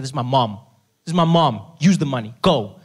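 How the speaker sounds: loud, firm insistence; emphatic demand. Retold or imitated. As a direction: demand it, no arguing. Pleading son demands treatment.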